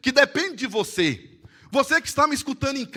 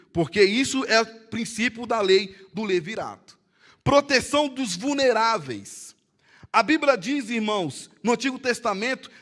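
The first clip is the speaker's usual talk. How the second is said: normal talking voice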